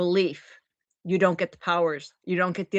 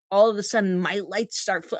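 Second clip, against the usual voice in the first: ordinary talk, nothing marked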